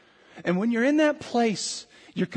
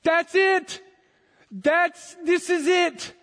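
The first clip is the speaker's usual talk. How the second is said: jumping up, much higher and louder; excited exclamation; 10 semitones higher, 3 dB louder, slower than usual. Retold or imitated. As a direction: shout it as a sudden discovery. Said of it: "I said".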